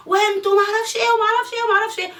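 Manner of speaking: high, laughing sarcastic complaint; laughing mockery. Real, not acted. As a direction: laugh it off while mocking how she's blamed. Mocking the blame she gets.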